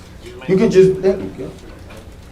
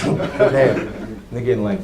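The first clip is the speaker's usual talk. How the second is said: normal talking voice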